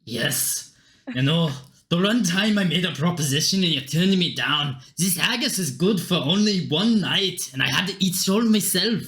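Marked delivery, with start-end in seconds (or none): 1.1-9.0 wounded Scottish brogue; Scottish accent. Acted. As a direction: lament a rejection in a Scottish accent. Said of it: "Don't know why he's Scottish".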